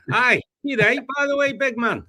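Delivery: a hammed-up Scottish hard man greeting; mock Scottish big-man voice; Scottish accent. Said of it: "in a Scottish accent".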